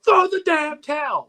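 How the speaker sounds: very high, slow, strained coach cry; Duke impression. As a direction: stretch it out like a cornerman. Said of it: "that clip of Duke just hit".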